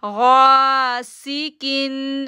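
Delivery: गासिकिन chanted very slowly with गा held two seconds; slow tajweed chant; drawn-out recitation. Shown as a demonstration. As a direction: hold the गा in गासिकिन. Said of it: "गाइन को हम खींचेंगे दो second के लिए".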